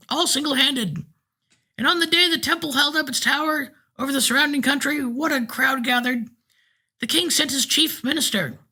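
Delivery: put-on old man recounts building his temple, piping and proud. A narrator's reading. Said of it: "in a thin, piping voice".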